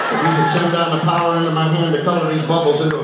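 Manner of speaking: loud pleading prayer; desperate student prayer. Retold or imitated. Schoolboy praying for a seventy-two on test, loud pleading. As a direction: beg God loudly for a passing grade.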